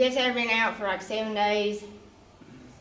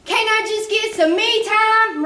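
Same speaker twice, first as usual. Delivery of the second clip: higher, louder, drawn-out plea; exasperated mom; 8 semitones higher, 5 dB louder, slower than usual. Retold or imitated. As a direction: beg for a minute like a worn-out mother. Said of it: "And me saying".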